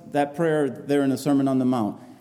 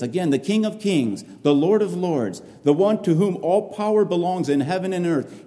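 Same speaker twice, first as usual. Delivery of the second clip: usual speaking voice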